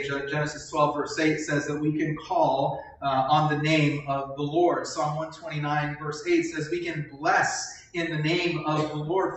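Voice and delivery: normal talking voice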